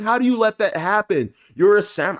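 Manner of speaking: high, loud exasperated scolding; incredulous rant at a failed samurai; mock scolding. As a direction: chew her out in disbelief. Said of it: "I wanted to cuss her out".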